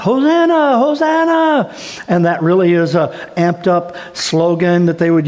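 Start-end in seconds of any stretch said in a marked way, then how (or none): 0.0-1.7 hollering out. Retold or imitated. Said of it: "these people are hollering out".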